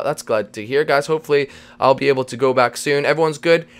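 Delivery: normal talking voice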